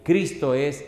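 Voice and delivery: loud, emphatic sermon delivery with stretched key words; impassioned preacher, punching phrases and dropping for the closing words; forceful preaching. Real, not acted. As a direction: drive each point home to the congregation.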